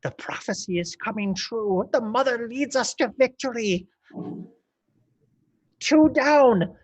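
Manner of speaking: a zealous goblin proclaiming the prophecy. Acted. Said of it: "he's like".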